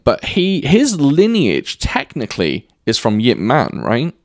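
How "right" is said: usual speaking voice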